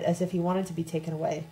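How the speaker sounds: ordinary delivery, nothing marked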